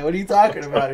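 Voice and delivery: a mock of a confused host. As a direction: ask it puzzled and high. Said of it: "he's like".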